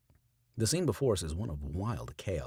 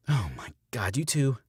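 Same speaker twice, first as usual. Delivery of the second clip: rising, louder scold; 6 semitones higher, 6 dB louder than usual. A narrator's reading. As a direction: tell them off half laughing. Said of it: "Stella scolds".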